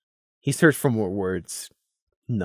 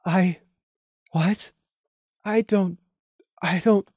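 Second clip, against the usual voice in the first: higher, halting stammer; startled rasp; 8 semitones higher, slower than usual. Acted. Lemon stammering, startled by Prey's rage. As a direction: caught off guard, words failing. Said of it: "she rasped".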